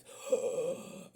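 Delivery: sharp offended gasp; mock gasp. Telling off people at church, a gasp. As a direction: gasp in fake shock.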